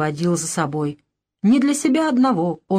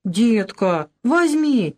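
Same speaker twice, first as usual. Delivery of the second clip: put-on pestering child; 3 semitones higher, much slower than usual. A narrator's reading. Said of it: "клянчил Артёмка".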